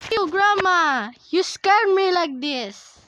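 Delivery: high, loud outburst at Grandma; startled scolding. Real, not acted. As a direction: shout it right after a scare. Scolding Grandma after jump scare.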